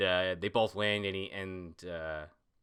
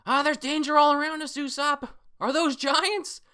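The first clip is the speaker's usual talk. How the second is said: Chopper voice; 9 dB louder than usual. Retold or imitated. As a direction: squeal it like a frightened tiny reindeer. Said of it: "Chopper's going".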